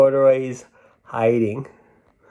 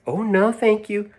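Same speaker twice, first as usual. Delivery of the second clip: put-on higher, sweet little girl politely declining; 10 semitones higher than usual. Lisa declining the box to the saleslady, in a higher girlish voice. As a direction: voice the child brightly and higher.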